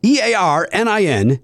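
letters "E A R N I N" spoken one by one; spelling it out. Shown as a demonstration. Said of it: "Let me spell it for you".

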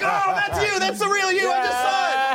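very high-pitched comic squeal; high mock voice. Realizing that's the real him, absurdly high voice.

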